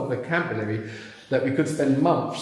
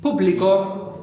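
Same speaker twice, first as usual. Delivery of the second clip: "Público" slowly as POO-blee-ko, high on POO; first-syllable stress; 6 semitones higher, 4 dB louder, slower than usual. Shown as a demonstration. Teacher keeps the accent unchanged, "Público" stressed on the first syllable. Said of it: "We don't have a change in accent".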